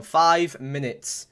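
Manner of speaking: slowly spelled out, x0.4; slow emphatic. Real, not acted. Streamer stressing added time, slow. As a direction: stretch each word for the viewers. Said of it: "I repeat that".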